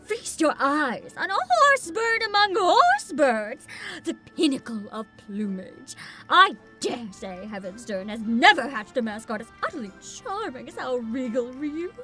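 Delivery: loud, proud, grand presenter; pompous showman. Acted. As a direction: boast about the mascot grandly. Showy NPC presents mascot.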